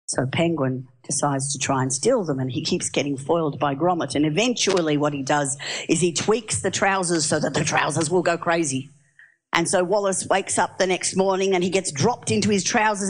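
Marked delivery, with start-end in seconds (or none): none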